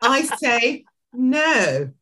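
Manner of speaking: slow, high, accented "I say no"; code-switched accent. Shown as a demonstration. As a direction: echo it with the accent, slowly. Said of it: "did we just code switch there".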